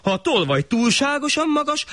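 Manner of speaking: high pleading suggestion; the poor man reasoning with the shah; high earnest proposal; clever poor man. Acted.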